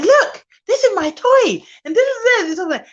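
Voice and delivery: a light hint of a child showing off, high; excited child. Mimicking children on a Zoom call, lightly childlike. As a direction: mimic a kid showing toys. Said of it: "They'll be like".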